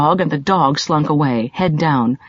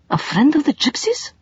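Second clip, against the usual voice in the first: high, uncertain, faltering question; 10 semitones higher than usual. A narrator's reading. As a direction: let it falter in disbelief. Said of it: "faltered Sabahat".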